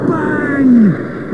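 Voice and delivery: loud, high exclamation; explosive bang. A narrator's reading. Narrator voices the mould machine blowing, an explosive bang. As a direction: burst out with it like a blast.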